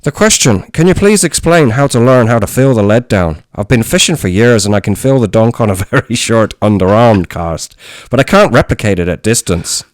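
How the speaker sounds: put-on reading a listener's question in a loud Irish accent; reading Phil's fishing question in an Irish accent. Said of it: "in his accent?".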